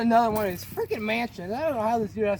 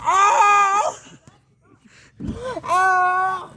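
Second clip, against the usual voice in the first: long, high, loud moan; drawn-out high wailing moan; 11 semitones higher, 9 dB louder than usual. Shown as a demonstration. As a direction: moan really loud, held out. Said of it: "You gotta moan really loud".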